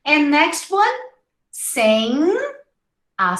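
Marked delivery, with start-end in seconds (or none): none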